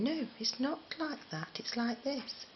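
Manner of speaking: high-pitched imitation of a correcting mother; correcting mum. Mum correcting how her child speaks, imitated correcting mother. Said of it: "it was just my mum saying".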